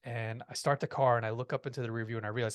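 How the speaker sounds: ordinary delivery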